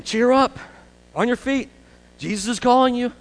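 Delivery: rally him with a high call; encouraging call. Acted. Crowd calling the blind man to Jesus, high urging.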